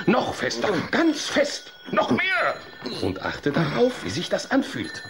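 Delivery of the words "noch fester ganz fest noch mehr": urging on. Acted. Urging harder fist clench.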